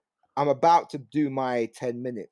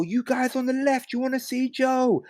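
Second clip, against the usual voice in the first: hyping the crowd. Retold or imitated. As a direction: call out to rev up the crowd.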